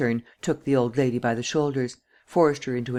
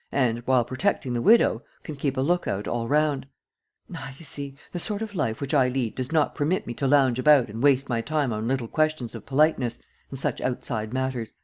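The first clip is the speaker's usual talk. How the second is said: usual speaking voice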